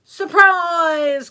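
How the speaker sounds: big, high, gleeful shout; loud pouncing storybook yell; wolf's cry. Acted. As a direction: burst out loudly to startle Granny. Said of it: "cried the wolf".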